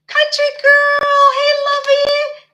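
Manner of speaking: very high, loud, drawn-out greeting; sing-song squeal, stretched out slowly; playful squeal. Pretend. Reader greeting a favorite viewer, playful squeal.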